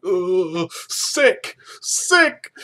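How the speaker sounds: high, loud disgusted shrieks; mock yelling. Host imitates Claire yelling in a field, hammed-up disgust. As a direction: screech each word on its own. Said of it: "standing out in the middle of a field yelling".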